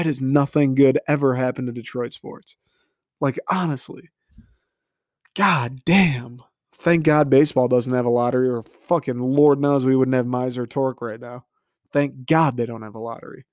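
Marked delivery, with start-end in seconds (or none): none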